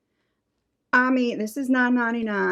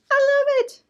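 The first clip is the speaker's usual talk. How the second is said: much higher, louder excited squeal; delighted squeal; 5 dB louder than usual. Real, not acted. Host thrilled by hair bows, high delighted squeal. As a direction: squeal it out with joy.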